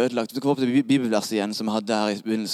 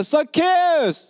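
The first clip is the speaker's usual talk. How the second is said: high, ringing call of a name; Jesus calling out; 4 dB louder than usual. Acted. Jesus turns to Zacchaeus, calling out high. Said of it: "Jesus snur seg mot Zacchaeus og roper".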